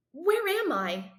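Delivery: high, slow, insistent question; demanding. Acted. Confused boy demands answers from strangers, high and slow. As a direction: press for an answer, bewildered. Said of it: "I demand".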